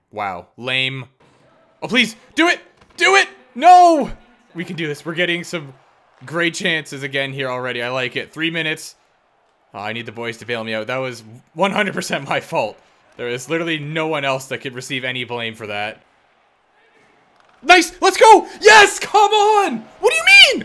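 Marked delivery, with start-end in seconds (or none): 1.8-4.1 high yelling do it, do it, then a loud cry of no; real excited shouting at the screen; frantic pleading. Real, not acted. Begging for a goal, then dismay. 17.6-20.6 high, loud yells of joy turning into outrage; real gamer yelling at the TV; goal celebration shout. Real, not acted. Celebrating a goal then protesting.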